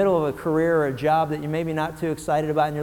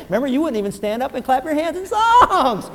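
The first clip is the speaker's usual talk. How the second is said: loud, high gleeful ribbing; loud, high mock-gloating joy; playful teasing; 10 semitones higher, 7 dB louder than usual. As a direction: tease them with delight. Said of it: "I'm gonna come over to you and say".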